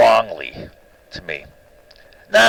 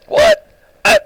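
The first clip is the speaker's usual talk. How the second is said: a sudden, very high, louder disbelieving "What?"; incredulous what; 5 dB louder than usual. Real, not acted. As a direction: toss out the word in shocked disbelief. Incredulous at being called crazy.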